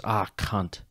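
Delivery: understated imitation of a Finnish accent. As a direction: quote him in a light Finnish accent. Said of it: "in his Finnish accent".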